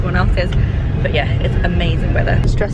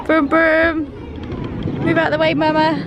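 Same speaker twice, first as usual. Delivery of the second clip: high playful engine noises and talk; playing toddler driver; 8 semitones higher than usual. Mum speaking for her driving toddler, high playful voice. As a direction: pretend to drive, pitched up.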